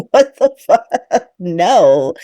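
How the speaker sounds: slow, amused what the fuck; laughing disbelief. Real, not acted. Reacting to Danny's secret with a disbelieving laugh.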